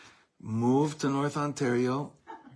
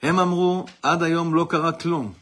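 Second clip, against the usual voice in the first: loud recital; 6 semitones higher, 10 dB louder than usual. Acted. Declaiming the poem's refrain.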